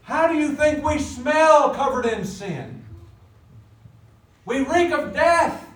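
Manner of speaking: loud, high, slow pulpit cry; full-force preaching. Real, not acted. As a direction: thunder it slowly at the congregation.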